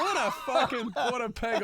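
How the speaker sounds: squeaky-high words through real laughter; laughing. Real, not acted. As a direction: crack up while quoting her. Laughing at own story's punchline.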